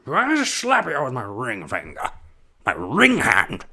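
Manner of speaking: a hint of old Connery slurring; old Sean Connery. Old Connery voiced in skit.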